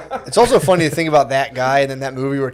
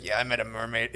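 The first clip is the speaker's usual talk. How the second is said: put-on low soft old sailor; 5 semitones lower, 15 dB quieter than usual. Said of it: "he's like".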